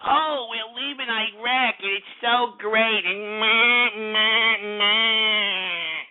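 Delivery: slow, mock-sappy media voice; lightly voiced mockery of the news. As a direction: parody the gushing news clip. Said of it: "romantic type freaking B-roll clips".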